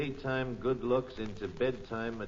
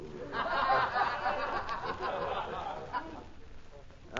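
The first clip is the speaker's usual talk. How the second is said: long high chuckle; hearty laugh; 12 semitones higher than usual. Real, not acted. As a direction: let a big laugh roll out. Laugh heard.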